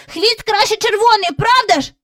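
put-on high, eager boy voice; boy asks his mother, high child voice; child's voice. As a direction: ask like an eager little boy. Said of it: "спитав Майкл".

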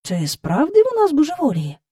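usual speaking voice

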